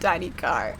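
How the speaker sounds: usual speaking voice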